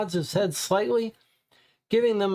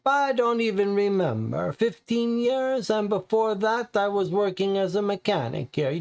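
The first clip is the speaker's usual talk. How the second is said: lightly voiced Italian mechanic, higher; the garage owner's Italian accent, a bit higher; 5 semitones higher than usual.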